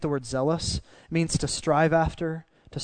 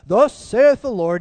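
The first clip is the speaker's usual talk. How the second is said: higher, louder, slow mock King James proclamation; weird Old English voice; 6 semitones higher, 8 dB louder, slower than usual. Preacher mocks how people deliver prophecy, in hammed-up Old English. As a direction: intone it like an old-fashioned prophet. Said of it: "in a really weird Old English voice".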